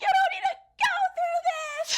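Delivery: very high, drawn-out mock wail; hammed-up crying damsel, thin and high; tropey heroine. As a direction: squeal a melodramatic tearful plea. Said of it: "tropiest of tropes of just, like".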